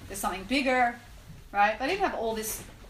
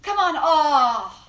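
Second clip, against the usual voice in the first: slow, louder drawn-out gambler's groan of loss; 6 semitones higher, 11 dB louder, much slower than usual. Retold or imitated. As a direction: stretch it out like a losing gambler. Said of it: "And I hear his voice".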